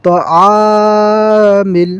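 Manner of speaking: তাআমিল with the আ held long and slow; lengthened vowel. Shown as a demonstration. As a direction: stretch the আ in তাআমিল. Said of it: "এই আ এখানে আমাদেরকে টান দিতে হবে".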